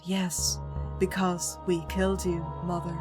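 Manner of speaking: ordinary talk, nothing marked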